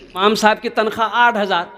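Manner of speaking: normal talking voice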